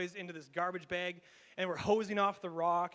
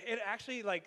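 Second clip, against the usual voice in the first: ordinary talk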